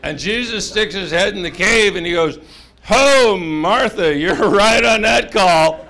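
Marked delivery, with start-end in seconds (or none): 2.8-5.8 loud, high, half-laughing protest; comic recoil. Acted. Jesus backing out of the smelly cave, loudly appalled. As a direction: play it as a big mock recoil.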